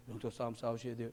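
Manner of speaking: a soft deferential plea; meek polite request. Retold or imitated. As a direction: say it gently, as if afraid to offend. Polite version nobody understands.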